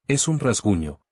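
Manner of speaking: ordinary delivery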